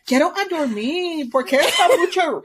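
high Spanish-speaking dog voice; Russell. Acted. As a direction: complain in Spanish as a put-upon dog. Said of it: "doing that voice for everything".

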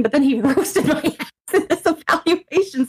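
high voice breaking up with laughter; laughing. Real, not acted. Patient telling a friend about the examiner's roast, laughing. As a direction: laugh your way through the complaint.

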